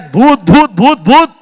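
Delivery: high, very loud repeated cries; disciples screaming. Acted. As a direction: shout it over and over in acted panic. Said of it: "शिष्य ओरडतायत".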